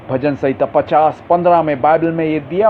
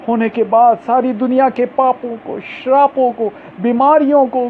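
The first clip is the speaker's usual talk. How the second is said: higher and louder, pounding each item; full-force preaching; 9 semitones higher, 4 dB louder than usual. Acted. Preacher proclaiming the cross to the congregation, high and loud. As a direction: hammer the list home from the pulpit.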